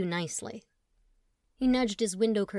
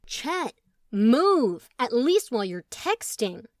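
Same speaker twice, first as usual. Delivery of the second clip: annoyed demand; 3 semitones higher, 7 dB louder, much slower than usual. Acted. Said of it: "Truman tried again".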